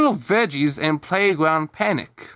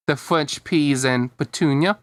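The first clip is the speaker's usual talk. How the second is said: ordinary delivery, nothing marked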